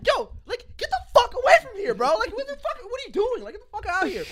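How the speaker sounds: high, loud acted telling-off; retold angry confrontation. Car owner orders the crackhead away from his car, high angry yelling. Acts out chasing the man away.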